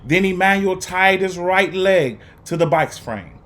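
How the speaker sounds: put-on expressive read-aloud for young students; lively, paced story reading with dramatic pauses; storybook narration. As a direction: read it like a teacher sharing a picture book with the class. Teacher reads picture book aloud.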